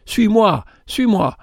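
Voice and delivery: powerful yet gentle plea. A narrator's reading. Stranger calling the brother away from his raft, powerful yet gentle. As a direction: urge him gently but with power. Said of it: "cette voix qui dominait, puissante et douce".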